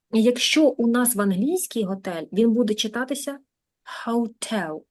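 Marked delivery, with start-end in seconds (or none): 4.1-4.8 "hotel" stressed on the TEL; stress on second syllable. Shown as a demonstration. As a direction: say "hotel" as ho-TEL, teacher demonstrating. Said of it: "Почули наголос на другому".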